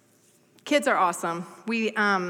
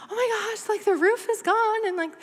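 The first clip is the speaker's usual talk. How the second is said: high-pitched frantic phone voice; acted-out alarm, pitched way up; retold panic; 12 semitones higher than usual. Wife calling husband about the flooding house, mock panicked.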